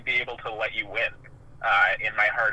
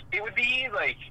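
ordinary talk

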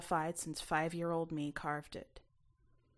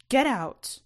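higher and louder, seething; furious order; 7 semitones higher, 10 dB louder than usual. A narrator's reading. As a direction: order him out in rage. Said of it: "Mom's hiss was like hot coals".

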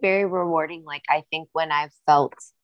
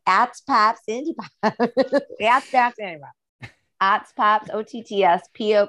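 broad Midwestern vowels, higher; Midwestern accent; 5 semitones higher than usual. Shown as a demonstration. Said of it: "with, like, my Midwestern accent too".

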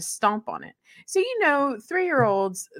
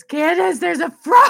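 panicked call; 9 semitones higher, 9 dB louder than usual. Retold or imitated. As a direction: shout for Candace, higher and louder. Woken by a frog, calling out.

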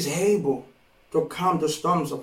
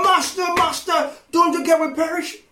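frantic disciples; 9 semitones higher than usual. Retold or imitated. Voicing frightened disciples.

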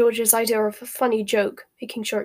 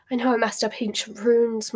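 usual speaking voice

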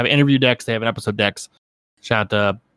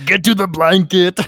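put-on slow, higher, louder Austrian action-hero accent; Arnold Schwarzenegger impression; 7 semitones higher, 5 dB louder, slower than usual. Doing Arnold Schwarzenegger at a picnic in a hammed-up Austrian accent. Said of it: "Arnold Schwarzenegger impression at a picnic".

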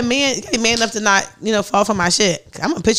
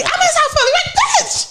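much higher, fed-up brush-off; high exasperated snap. Retold or imitated. Woman mimicking herself brushing off a nosy friend, exasperated. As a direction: get her off your back.

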